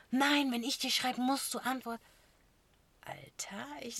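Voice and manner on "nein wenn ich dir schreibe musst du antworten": hammed-up demanding boyfriend; half-hearted retold shouting; mock controlling yell. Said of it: "Schreit mich an".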